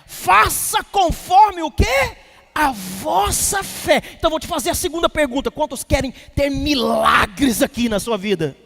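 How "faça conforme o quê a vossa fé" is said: slow, loud, high preaching call; preaching shout. Real, not acted. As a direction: drag the words out and call to the church. Preacher calls out to congregation.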